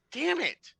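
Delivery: high-pitched exasperated outburst; sudden high yelp of defeat; Martin. Acted. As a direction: curse in comic frustration. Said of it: "Martin's like".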